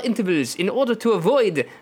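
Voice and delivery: laboured, thick mock French accent; exaggerated French accent. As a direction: explain it as a caricature French official. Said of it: "I would have to say in this accent".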